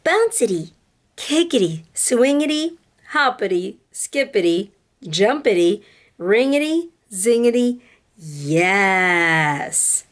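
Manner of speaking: put-on expressive read-aloud to children; lively classroom storytime voice; storybook reading. A narrator's reading. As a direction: tell it like a picture book to kids. Reads a picture book aloud to class.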